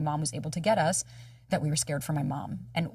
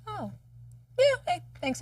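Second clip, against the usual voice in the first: high-pitched little thanks; small, high voice of relieved gratitude; acted validated relief; 11 semitones higher than usual. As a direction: answer in a high, touched voice. Acting out feeling validated.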